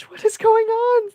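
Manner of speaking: high, loud cry of disbelief; overwhelmed astonishment. Real, not acted. Host to viewers as donations pour in, astonished. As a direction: pitch shooting up in real amazement. Stunned by more donations arriving.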